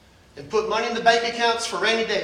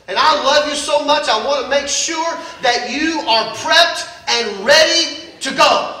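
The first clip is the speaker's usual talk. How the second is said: loud, high, driving preacher's call; loud revival preaching; full-force preaching; 5 semitones higher, 7 dB louder than usual. Acted. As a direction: preach it out with fire and urgency.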